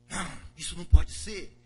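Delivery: loud outburst of denial; angry refusal. Acted. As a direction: blurt it out, outraged. Broer weigert boos.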